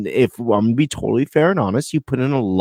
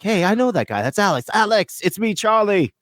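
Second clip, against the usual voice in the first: mock Charlie greeting; 8 semitones higher than usual. Imitating Charlie greeting Alex.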